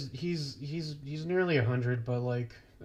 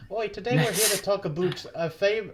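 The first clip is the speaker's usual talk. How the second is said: higher-pitched Canadian attempt, slipping into Irish; failed Canadian accent; 5 semitones higher than usual. Host retries the mock introduction, botched Canadian-Irish accent. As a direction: try Canadian and land on Irish. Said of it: "I don't know Canadian".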